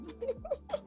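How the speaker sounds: high breathy burst of laughter; high laughing burst; high giggle. Real, not acted. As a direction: laugh at her own trouble. Laughing at accent struggles.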